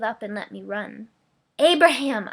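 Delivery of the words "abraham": loud angry call of the name; yelled in rage. Acted. Wife yelling in rage after her husband. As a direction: hurl the name out in fury. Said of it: "I yelled in rage".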